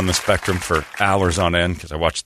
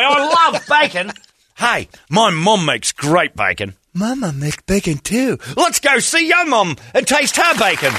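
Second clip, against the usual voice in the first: high excited daters; 12 semitones higher than usual. Retold or imitated. Mocking daters meeting the family, giddy and high. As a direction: gush like a happy dog. Voicing dogs excited by bacon.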